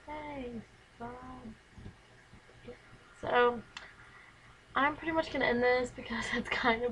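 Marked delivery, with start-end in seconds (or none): none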